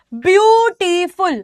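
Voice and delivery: ब्यूटीफुल drawn out slowly as ब्यू-टी-फुल; syllable by syllable. Shown as a demonstration. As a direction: sound out each syllable of ब्यूटीफुल. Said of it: "जैसे अगर मैं word बोल के देखती हूँ".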